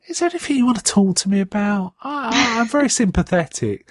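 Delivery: high mock sympathetic. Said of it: "And she says".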